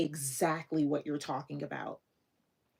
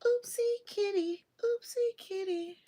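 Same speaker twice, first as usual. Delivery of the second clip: high cooing voice to a kitty; 13 semitones higher than usual. Real, not acted. Talking to cat, crying sound.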